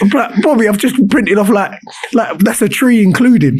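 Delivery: hammed-up protesting pupil, laughing; whiny student. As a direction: whine like a student caught out. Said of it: "he'd be like".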